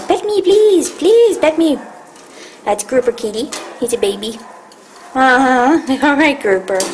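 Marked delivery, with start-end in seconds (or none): 0.1-1.8 voicing the cat. Owner voicing her begging cat Grouper, high pleading. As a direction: beg like a needy kitty. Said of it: "Grouper is like".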